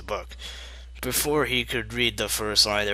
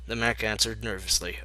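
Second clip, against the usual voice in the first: plain reading voice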